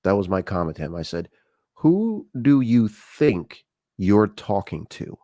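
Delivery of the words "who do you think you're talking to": very slow, deliberate challenge; slow-motion acted comeback at normal volume; indignant retort. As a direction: draw out each word in confrontation. Repeats his retort to stranger.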